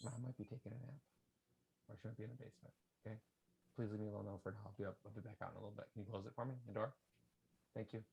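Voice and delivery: quiet aside. Real, not acted. Father softly asking his daughter to wait, hushed.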